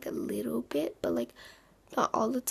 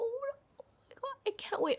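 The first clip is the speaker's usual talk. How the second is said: excited; 11 semitones higher, much faster than usual. Real, not acted. Thrilled about her own room.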